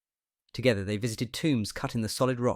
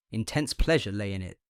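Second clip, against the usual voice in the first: ordinary delivery, nothing marked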